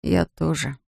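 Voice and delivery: small, soft admission; hushed, sheepish confession; quiet and ashamed. A narrator's reading. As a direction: own up to it quietly, a touch ashamed. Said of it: "произнёс тихо и пристыженно".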